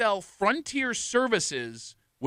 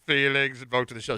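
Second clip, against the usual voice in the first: medieval voice; 5 semitones lower than usual. Acted. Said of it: "my medieval voice".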